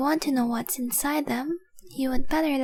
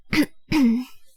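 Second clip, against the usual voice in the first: loud, self-conscious throat clear; 6 dB louder than usual. Acted. Snapping out of trance.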